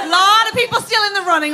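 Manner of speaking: ordinary talk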